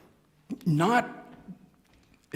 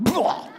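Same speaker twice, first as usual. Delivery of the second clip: barfing sound; 12 semitones higher, 5 dB louder than usual. Acted. Whale barfing Jonah out.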